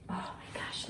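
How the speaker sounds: breathless whispered wonder; awed whisper. Real, not acted. Overwhelmed by the prize pile, whispering in awe.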